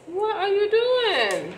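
second take, hammed-up and incredulous. Real, not acted. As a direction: stretch it out in total disbelief. Repeats the question, more incredulous.

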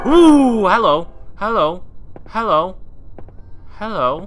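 high, loud repeated hellos; startled greeting. Real, not acted. Player startled in the game, high loud greeting. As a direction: call out high, each hello swooping.

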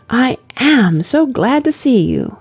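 put-on girlish and delighted; eager young girl. A narrator's reading. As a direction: greet him with slow, heartfelt delight.

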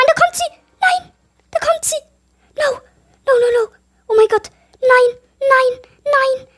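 high, loud panicked cries; terrified screaming. Real, not acted. Gamer sees the monster coming, high panicked screaming. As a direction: shriek as the monster comes. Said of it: "ich hab so Angst".

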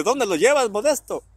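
neighbor calling out. Retold or imitated. Neighbor don Chicho calling out to dad with his kids, high and loud. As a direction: call out to the neighbor passing by.

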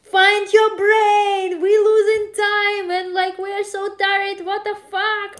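high, loud, slow at first, easing off by the end; scolding a friend for wasting time, tiring out; retold angry yelling, fading. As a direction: berate her, then let the volume drop. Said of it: "And I was yell at her like".

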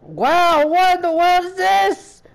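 high-pitched, incredulous exclamation; astonished outcry. Real, not acted. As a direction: blurt it out high in disbelief. Shocked by chaos in game.

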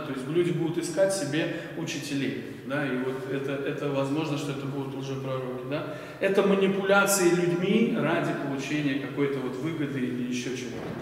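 ordinary talk, nothing marked

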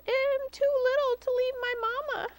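high, whiny little-pig voice; little piglet voice. A narrator's reading. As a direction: plead like a tiny child. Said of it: "Oinky squealed".

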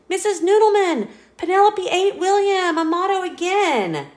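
high, loud, fast telling-on; tattling child. Acted. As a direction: rush to tell the teacher. Classmate tattling to teacher.

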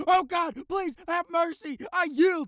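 high, loud hammed-up begging; desperate pleading. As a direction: beg for mercy in panic. Pretend begging surrender.

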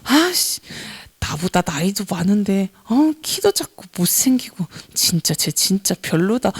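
low, soft, resentful muttering; hateful inner grumbling. Retold or imitated. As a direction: think spiteful thoughts aloud under your breath. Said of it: "이렇게 생각하면서". (속으로 낮게 투덜대듯)

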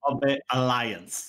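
slow, drawn-out English accent on the team name. Shown as a demonstration. Caster asked for the team name, hammed-up English accent. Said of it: "avec un magnifique accent?".

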